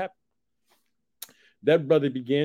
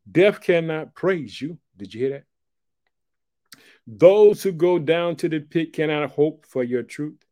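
loud, slow, ringing pulpit reading; preaching at full force; 3 semitones higher, 5 dB louder, slower than usual. Acted. Preacher declares Hezekiah's words to the church, loud and preached. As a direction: proclaim the lament to the back row.

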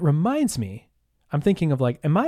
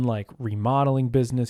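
ordinary talk, nothing marked

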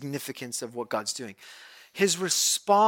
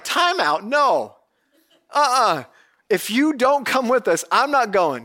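Moses protesting; 6 semitones higher, 5 dB louder than usual. Retold or imitated. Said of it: "Moses is like".

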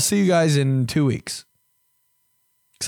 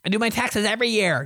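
higher, faster mock brag; mocking smug person; 8 semitones higher, faster than usual. Host mocking people who claim to do their own taxes, higher and quick. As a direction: parrot a smug know-it-all. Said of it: "And if you know how to do 'em".

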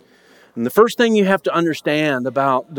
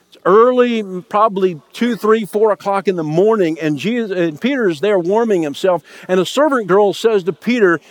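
ordinary talk, nothing marked

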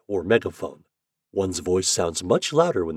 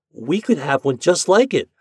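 put-on higher, louder boyish hope; bright-eyed hopeful child; 7 semitones higher, 6 dB louder than usual. A narrator's reading. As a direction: suggest it hopefully, like an eager child. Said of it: "said Ben hopefully".

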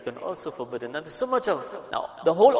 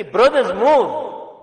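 shouted louder and higher; urgent shout; 6 semitones higher, 10 dB louder, much slower than usual. Shown as a demonstration. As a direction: yell it like a real emergency. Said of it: "Emergency, urgency".

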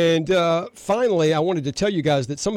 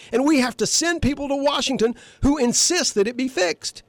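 worked up; 10 semitones higher than usual. Real, not acted. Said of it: "I'm getting a little worked up here".

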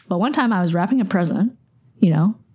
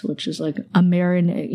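ordinary talk

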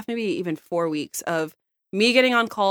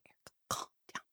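whispered, much softer boss quote; hushed boss; 21 dB quieter, faster than usual. Retold or imitated. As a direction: hush her like a boss in a meeting. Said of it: "My boss is like".

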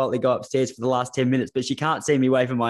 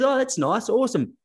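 much higher, breezy put-on lady voice; mock sweet voice; 10 semitones higher than usual. Host mimics the athlete's delighted reply in a high voice. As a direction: chirp it high like a delighted traveller. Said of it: "And she goes".

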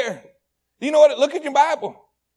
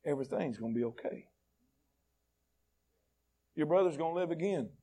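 lower, much softer, hushed comfort; tender reassurance; 8 semitones lower, 12 dB quieter, faster than usual. Acted. Jesus comforting grieving Martha, soft, low and tender. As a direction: soothe her like a hurting child.

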